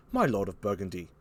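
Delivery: put-on low, quiet British-accented address; France. France addressing Burgundy, quiet low British accent. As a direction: turn to him calmly in a British voice. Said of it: "Here's France".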